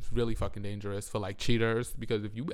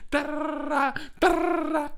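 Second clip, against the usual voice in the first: trilled, high-pitched stand-in for ranting; high rattling "da-da-da" tirade noise; 14 semitones higher, 5 dB louder than usual. Retold or imitated. Storyteller skipping through her own rant, high trilled noise. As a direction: rattle it off like rapid fire.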